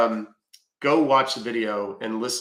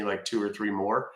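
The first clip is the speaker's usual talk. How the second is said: ordinary talk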